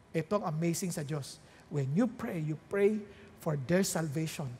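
ordinary talk

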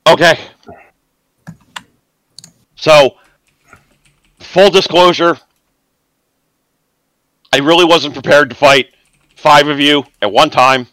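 a cornered rat boss stalling for his life; nervous cornered villain. Acted. GM voices cornered NPC Rourke.

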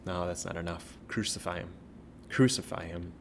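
usual speaking voice

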